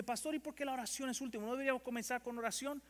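high, fast puzzled question; a listener in the pews challenging the pastor, high and quick; congregant's question. Retold or imitated. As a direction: play a churchgoer questioning the pastor. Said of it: "usted dice".

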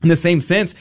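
ordinary talk, nothing marked

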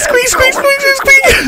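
very high squeaking; tiny frantic squeaks; hamster squeaks. Retold or imitated.

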